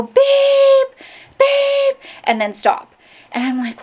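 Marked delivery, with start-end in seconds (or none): 0.0-2.0 a sharp, much higher electronic beep; mimicked alarm beep. Vlogger imitating the fire alarm beeping at 3 AM. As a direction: chirp it like a smoke alarm. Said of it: "it would just go".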